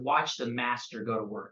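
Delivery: low, hushed cocky boast; retold show-off line, dropped low and soft. Young agent showing off for friends, cocky and low-key. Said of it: "really cocky 'cause I was putting on a show".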